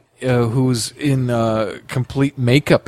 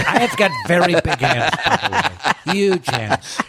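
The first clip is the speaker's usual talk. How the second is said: Trump voice; 3 semitones higher than usual. Pretend. Trump riff on big hands.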